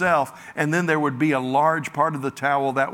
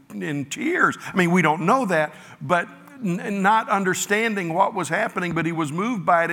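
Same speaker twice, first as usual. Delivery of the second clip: usual speaking voice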